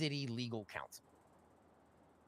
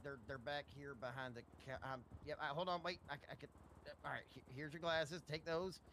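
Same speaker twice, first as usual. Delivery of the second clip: muttering while searching; 3 semitones higher, 10 dB quieter than usual. Real, not acted. Fumbling for glasses nearby.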